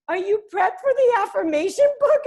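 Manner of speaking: high, loud, laughing mock mom; eager mom. Daughter imitating her mom asking about the book release. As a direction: ask like a thrilled mother.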